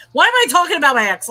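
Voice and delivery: real exasperation at yourself, quick and loud; exasperated self-scolding. Real, not acted. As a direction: catch yourself rambling and burst out loudly. Scolds herself for bringing up ex.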